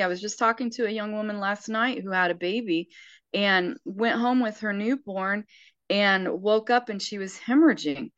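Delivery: normal talking voice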